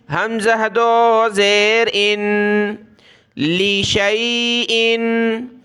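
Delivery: slow syllable-by-syllable reciting; letter-by-letter recitation. Shown as a demonstration. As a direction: spell each sound slowly like a teacher. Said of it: "इस अलिफ को हमने नहीं पढ़ना".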